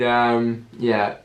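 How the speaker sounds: ordinary talk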